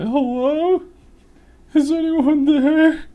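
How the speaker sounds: very high, loud, slow scared call; hammed-up frightened horror victim calling out; imitating girlfriend as a horror movie victim. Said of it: "like a victim in a horror movie".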